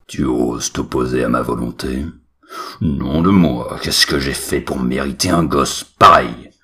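angry father. Acted. Father scolds son.

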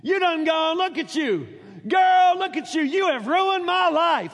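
loud, high, folksy scolding; exasperated father. Retold or imitated. Preacher voices Jephthah blaming the girl, hammed-up loud scolding. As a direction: blame her with hammed-up exasperation. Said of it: "That's what he says".

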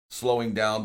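usual speaking voice

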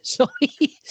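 ordinary talk

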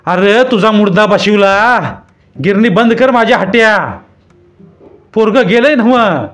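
high, loud scolding crone; angry old woman. A narrator's reading. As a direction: tear into the stubborn man. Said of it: "रागारागानं हात हलवत".

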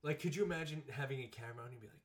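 ordinary talk, nothing marked

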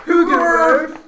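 high, loud, drawn-out holler of "Hoogerwerf"; mimicking a shouted name, slowly; imitated shouted surname. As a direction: bellow your surname as your friends do. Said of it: "that's exactly how everyone's calling me".

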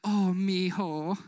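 slow, drawn-out mock endearment. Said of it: "somebody who you love, who cares about you".

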